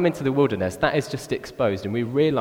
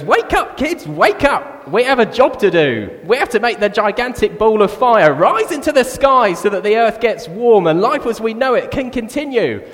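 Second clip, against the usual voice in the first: very high, loud crowing rallying cry; deluded cockerel; 9 semitones higher, 6 dB louder than usual. Retold or imitated. Cockerel rousing all his kids at dawn, high frantic shouting. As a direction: holler it like a cockerel who thinks he raises the sun. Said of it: "there is this cockerel".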